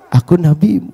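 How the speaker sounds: low, simple answer; composed Prophet. Acted. Prophet reveals himself to his follower, composed low reply. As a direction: drop low to answer the excited questioner.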